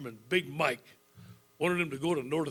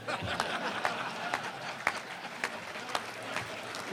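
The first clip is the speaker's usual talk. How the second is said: breathy laugh; 6 dB quieter than usual. Real, not acted. Laughing after punchline.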